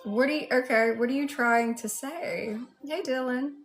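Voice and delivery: ordinary talk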